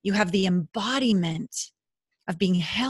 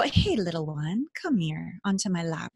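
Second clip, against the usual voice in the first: put-on tender to a child; 3 semitones lower, 5 dB quieter than usual. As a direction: coax a scared child onto your lap. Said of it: "just like we would our own children".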